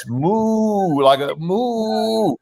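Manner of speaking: long, drawn-out high moo; imitated drugged mooing. Doctors mimicking what the sedated patient said, drawn-out mooing. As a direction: stretch each moo right out, slowly. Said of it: "you were saying".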